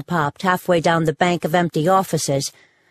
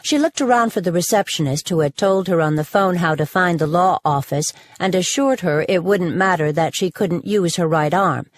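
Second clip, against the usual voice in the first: ordinary delivery, nothing marked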